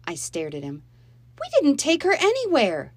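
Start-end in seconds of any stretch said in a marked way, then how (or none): 1.4-2.9 put-on much higher and louder, girl denying it; indignant protest. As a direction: deny it hotly. Molly protesting the accusation.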